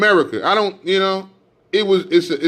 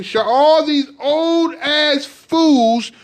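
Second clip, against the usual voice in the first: angry denunciation; 8 semitones higher, 3 dB louder, much slower than usual. Real, not acted. Denouncing elders by name.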